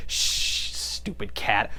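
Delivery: drawn-out shush, then low and soft; mock-menacing quiet murmur; hushed villain. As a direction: hush the cat, dropping low. Voices villain hushing knocked-out cat.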